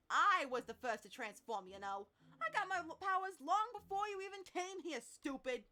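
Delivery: loud, heated bragging retort; bickering teammate, punching the words loudly; indignant retort. Acted. Acted quarrel over who transformed first.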